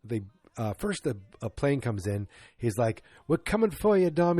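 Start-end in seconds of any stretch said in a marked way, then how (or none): 3.3-4.4 raised, higher character call. Retold or imitated. Movie character calls to Dom from the plane, calling out. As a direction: imitate a rescuer calling out.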